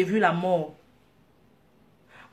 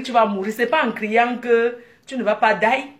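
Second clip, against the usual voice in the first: ordinary delivery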